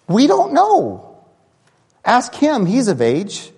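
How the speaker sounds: high, anxious parents passing the blame; nervous parents. Acted. Parents nervously dodging the Pharisees, voiced high and anxious. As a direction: squeak out an anxious we don't know.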